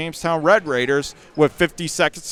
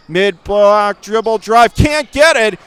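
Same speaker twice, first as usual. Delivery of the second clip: excited call; 5 semitones higher, 6 dB louder than usual. Real, not acted. Live call of a drive.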